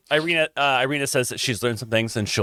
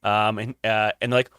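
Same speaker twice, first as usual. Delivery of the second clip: ordinary talk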